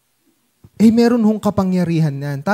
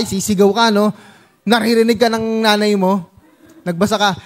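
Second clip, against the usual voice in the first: ordinary talk, nothing marked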